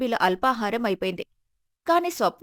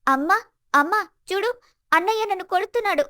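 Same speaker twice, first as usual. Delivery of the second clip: high-pitched whining call for mother; acted tearful complaint; tearful wail; 8 semitones higher than usual. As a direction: tattle to mother in a high, upset voice. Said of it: "స్వప్న ఏడుస్తూ కిందికి పరిగెత్తింది".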